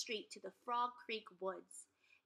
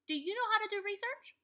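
excited question; 12 semitones higher, 5 dB louder, faster than usual. Real, not acted. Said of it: "Second graders".

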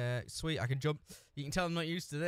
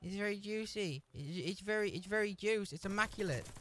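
mock cooing voice; 5 semitones higher, 4 dB quieter than usual.

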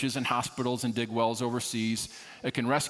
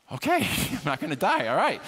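mock relief; 6 semitones higher, 3 dB louder than usual. Comic relief after expecting to die.

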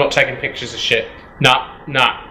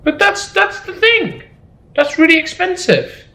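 excited. Real, not acted. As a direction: squeal over the find. Excited at spotting valuable item.

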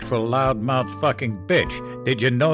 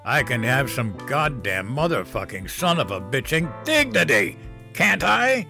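put-on drunken yelling; 3 semitones higher than usual. Drunk father yelling about his dignity, rising anger. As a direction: yell it at the top of his lungs.

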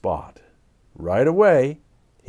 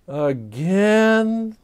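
moaning; 8 semitones higher than usual. Acted. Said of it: "moaned Arnold".

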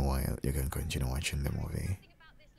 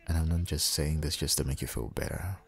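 ordinary talk

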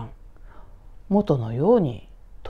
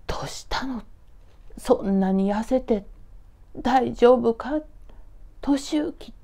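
worried mother; 6 semitones higher than usual. Acted. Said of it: "悲しそうな顔つきになって".

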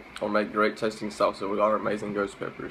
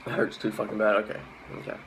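normal talking voice

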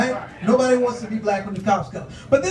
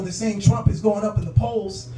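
usual speaking voice

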